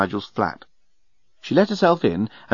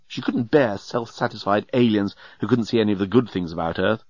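plain reading voice

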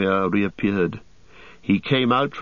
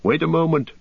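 high-pitched call to halt; high, pressing interjection; urgent cry; 8 semitones higher than usual. A narrator's reading. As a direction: call out to stop them. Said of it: "cried Aragorn".

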